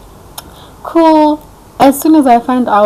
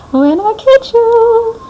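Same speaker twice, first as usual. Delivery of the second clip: much higher, louder, very slow squeal; squeaky mock threat; 5 dB louder, much slower than usual. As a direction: push the taunt up into a squeal. Second take climbs higher.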